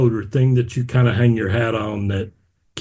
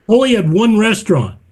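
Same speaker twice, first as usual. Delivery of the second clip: incredulous; 10 semitones higher, 5 dB louder than usual. Real, not acted. Disbelief at carpet order.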